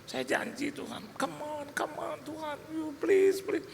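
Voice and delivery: high, hushed begging prayer; tearful pleading. Retold or imitated. Father begs God to let his baby live, pleading.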